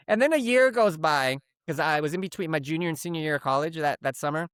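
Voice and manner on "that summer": normal talking voice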